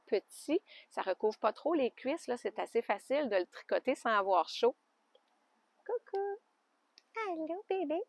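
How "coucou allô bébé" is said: cooing to a pet. Real, not acted. Cooing softly at her alpaca in the pen.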